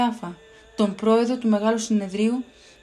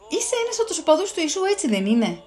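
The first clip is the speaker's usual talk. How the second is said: higher, quicker questioning voice; a man's pressing question; 7 semitones higher than usual. Acted. A man in the courtyard presses Peter, higher and quick. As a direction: press him fast for an answer.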